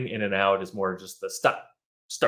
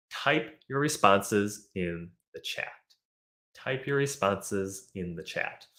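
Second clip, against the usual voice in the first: slow model sentence; 4 dB quieter, slower than usual. Shown as a demonstration. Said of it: "brings us to our last expression".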